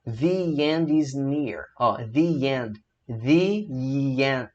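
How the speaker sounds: "The end" slow, thee with a Y glide; thee end slowly. Shown as a demonstration. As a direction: read "the end is near" with thee. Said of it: "Vamos ler comigo".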